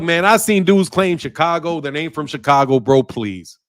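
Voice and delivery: ordinary talk, nothing marked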